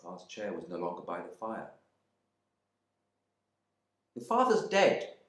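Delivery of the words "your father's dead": high, slow, pointed announcement; too prompt. Acted. Wife breaking the news to her husband, pointed. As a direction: blurt the news a touch too fast. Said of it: "she said a little too promptly".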